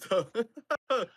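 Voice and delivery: high, loud burst of laughter; orcs bursting into laughter. Acted. Orcs laughing at what the human says, loud laughter. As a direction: bust up laughing. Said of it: "just bust up laugh-, laughing".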